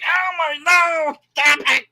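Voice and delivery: high, loud pleading groan; exasperated plea. Retold or imitated. Viewer begging the politicians to stop, high exasperated plea. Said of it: "it's like".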